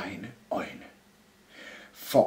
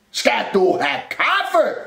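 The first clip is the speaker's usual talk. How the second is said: slow, high cupboard holler; shouted question; 12 semitones higher, 4 dB louder, slower than usual. A narrator's reading. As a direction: call it out slowly for all to hear. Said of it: "råbte skabet". (råber langsomt)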